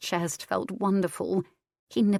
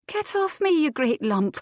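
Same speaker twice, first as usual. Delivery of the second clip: high sharp female complaint; exasperated wife; 10 semitones higher, 4 dB louder than usual. A narrator's reading. Wife orders heavy husband off her, exasperated. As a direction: shove him off, annoyed.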